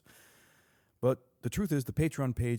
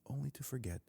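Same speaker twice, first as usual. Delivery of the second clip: much softer, fading repeat; hushed echo; 2 semitones higher, 9 dB quieter, faster than usual. Real, not acted. Podcaster on babies losing what they knew, hushed echo. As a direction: let "only to forget" drop almost away.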